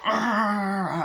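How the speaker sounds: high imitated roar; struggling yell. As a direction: yell out as in a fight. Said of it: "He's like".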